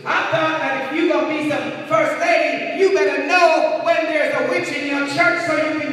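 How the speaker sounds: loud, high, emphatic sermon delivery; forceful preaching. Real, not acted. Preacher warning a church of deception, forceful preaching. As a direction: preach it out, leaning hard on key words.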